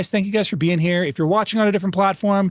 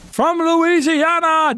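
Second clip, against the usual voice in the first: high, drawn-out theatrical proclamation; hammed-up announcer cry; 13 semitones higher, 4 dB louder, much slower than usual. Streamer hails a viewer from Louisiana, hammed-up announcer cry. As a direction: stretch it out grandly, pitched way up.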